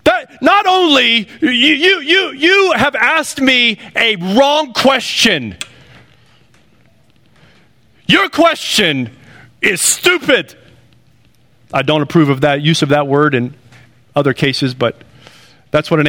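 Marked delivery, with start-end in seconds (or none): 0.0-10.6 high, offended, slowing on the accusation; mock the angry person justifying himself; hammed-up put-upon whine; defensive indignation. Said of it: "What do people do when you point out their sin?".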